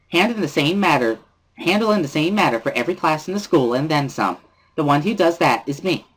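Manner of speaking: put-on high, bright-spirited girl pressing hard; sing-song scolding of the class rep; cheerful Misha. Misha scolding Lily about the late budget, high and chirpy.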